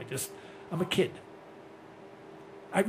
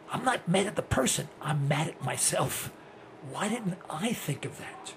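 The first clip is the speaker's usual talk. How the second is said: ordinary talk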